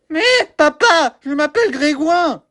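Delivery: put-on high little kid voice; child voice. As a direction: whine at dad like a small boy. Child voice answers father.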